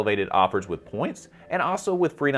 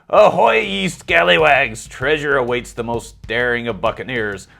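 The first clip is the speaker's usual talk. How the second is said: loud, high hammed-up pirate voice; 3 semitones higher, 4 dB louder than usual. Host reads a food promo as a pirate, hammed-up pirate voice. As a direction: ham it up like a hearty pirate. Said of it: "I'm gonna talk like a pirate".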